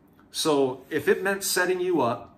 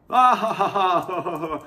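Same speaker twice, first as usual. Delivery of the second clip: mocking laugh; 4 semitones higher than usual. Cops laughing at him.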